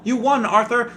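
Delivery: imitating the movie caller; hammed-up prize call; mock phone caller.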